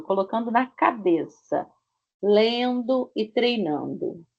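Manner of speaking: ordinary talk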